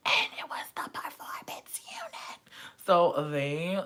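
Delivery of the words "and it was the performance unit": breathless whisper of awe; hushed whisper. Real, not acted. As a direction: whisper it like a big secret. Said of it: "hushed reveal".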